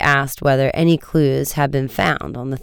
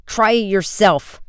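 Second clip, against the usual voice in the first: higher-pitched retort, only half shouted; 6 semitones higher than usual. A narrator's reading. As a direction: snap back in a contained shout. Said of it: "another shouted".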